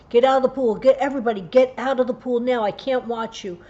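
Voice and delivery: panicked shout to clear the pool; screaming at kids. Retold or imitated. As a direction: yell it out, desperate mother. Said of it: "I started screaming at the kids".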